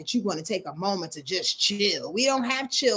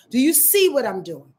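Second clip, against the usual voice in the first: ordinary talk, nothing marked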